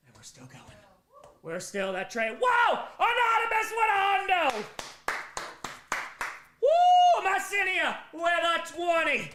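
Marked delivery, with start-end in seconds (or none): 2.4-2.8 loud, high, thrilled whoa at a big donation; excited whoa. Real, not acted. Donation spike, hype reaction. 3.0-4.6 shouted high with joy; excited shout. Real, not acted. Reading big donation, shouting. 6.6-7.2 very high, loud whoop of joy; loud cheering woo. Real, not acted. Cheer after big donation. 7.3-9.3 excited shout. Real, not acted. Hype reading donations.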